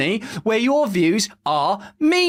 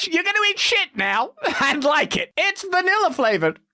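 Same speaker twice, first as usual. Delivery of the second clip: higher, laughing mock bully; mocking pushy authority; 6 semitones higher than usual. Sneering elite telling people to eat plastic, high and laughing. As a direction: gloat while forcing it on them.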